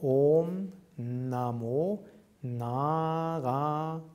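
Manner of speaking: Om Namo Narayanaya slowly, with long O, Naa, Raa, short Ya; long and short syllables. Shown as a demonstration. Teacher modelling the mantra, slow measured syllable lengths in Om Namo Narayanaya. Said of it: "on the pronunciation of this mantra".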